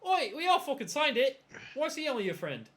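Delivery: put-on loud, high protest in character accent; hammed-up indignant shout. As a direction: butt in loudly, acting affronted. Character protests being left out.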